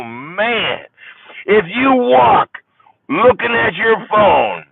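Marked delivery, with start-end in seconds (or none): 1.5-4.6 high, loud, slow comic punchline voice; Jeff Foxworthy. Retold or imitated. Jeff Foxworthy doing a stand-up bit, loud and slow. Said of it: "I went Jeff Foxworthy on you".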